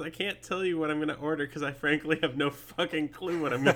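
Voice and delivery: reading aloud through laughter; giggling over the words; laughing while reading. Real, not acted. Reads own transcript, laughing.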